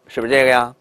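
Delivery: normal talking voice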